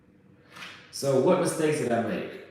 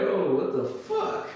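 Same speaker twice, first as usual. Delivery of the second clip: high, drawn-out disbelief; bewildered alarm; 7 semitones higher, slower than usual. Retold or imitated. Passenger reacting to a yelling armed cop, alarmed. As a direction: react in slow, high-pitched shock.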